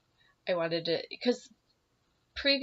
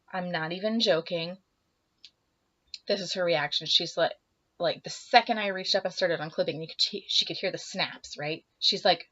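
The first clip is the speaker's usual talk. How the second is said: ordinary talk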